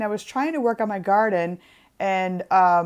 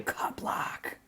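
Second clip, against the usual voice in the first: dramatic hushed whisper; 11 dB quieter than usual. Pretend. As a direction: breathe it like a secret. Mock dramatic reveal whisper.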